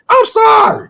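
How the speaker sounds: very high, squealed sorry; exaggerated high-pitched apology; mock apology. Real, not acted. Mock apology repeated for emphasis.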